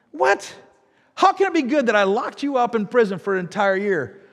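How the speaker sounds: sharp high disbelieving "What?"; incredulous king. Retold or imitated. King's incredulous reply.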